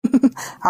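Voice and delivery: a short mocking chuckle; teasing laugh. Neighbour laughing at the fuming mother, teasing chuckle. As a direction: laugh at her temper, lightly.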